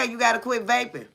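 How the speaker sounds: ordinary talk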